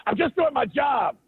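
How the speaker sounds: mild mock of a petty guard. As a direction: imitate him defensively whining. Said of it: "the guy goes".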